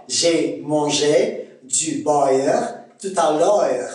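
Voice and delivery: slow, deliberate Québécois accent; Québécois demo, gliding "beurre" and "l'heure" into a-eur. Shown as a demonstration. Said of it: "On va dire quoi?".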